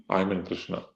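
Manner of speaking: hushed devout answer. Acted. A devotee replying to the teacher, hushed and sure. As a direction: say it like a simple truth, near a hush.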